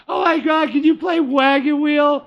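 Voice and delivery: high, loud squealing request; drunk party girl voice. Retold or imitated. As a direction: squeal it like a tipsy fan.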